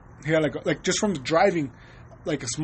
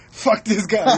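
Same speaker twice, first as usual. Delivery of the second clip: laughing mock curse; 4 semitones higher, 6 dB louder, slower than usual. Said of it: "So I'm like".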